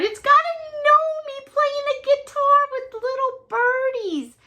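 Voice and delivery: high-pitched, delighted squeal; delighted gushing. Real, not acted. Showing viewers an adorable card, delighted gushing. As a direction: squeal over something adorably cute.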